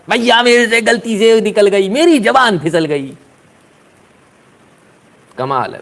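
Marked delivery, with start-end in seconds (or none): 0.1-3.1 high, slow, put-on pleading; mock cry for mercy; hammed-up whining plea, higher; whiny apology. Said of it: "फिर चिल्लाएगा".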